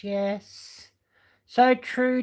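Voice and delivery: usual speaking voice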